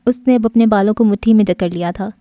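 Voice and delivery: plain reading voice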